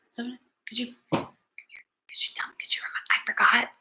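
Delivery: soft quick whisper; pretend forgetful whisper. Teacher pretending to forget, whispering to the kids. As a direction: whisper like she's lost it. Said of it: "Now I'm doing circle time".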